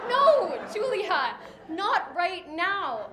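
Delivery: slow, laughing denial. Retold or imitated. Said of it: "and I said to her".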